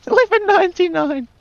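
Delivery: high laughing through the price. Real, not acted. Pricing the coffee at eleven ninety-nine, laughing high. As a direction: laugh while you read out the price.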